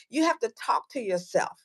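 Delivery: normal talking voice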